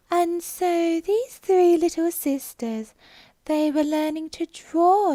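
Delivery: slow, high, drowsy little creature voice; sleepy Dormouse. Acted. Dormouse telling Alice its story, high and slow. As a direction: drawl it out like a half-asleep mouse.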